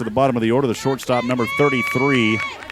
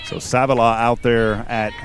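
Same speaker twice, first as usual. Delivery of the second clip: ordinary delivery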